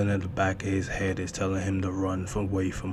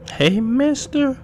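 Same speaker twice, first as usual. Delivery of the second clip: put-on creepy little girl voice; 6 dB louder than usual. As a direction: lure him in like a creepy child. Girl luring the man.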